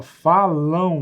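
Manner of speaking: falam drawn out slowly as falão; falão, the ão ending stretched. Shown as a demonstration. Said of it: "Dá a impressão que tá escrito assim".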